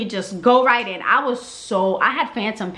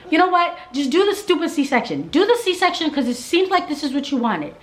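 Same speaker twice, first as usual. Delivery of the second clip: fed-up outburst; 8 semitones higher than usual. Retold or imitated. Giving in, demanding C-section.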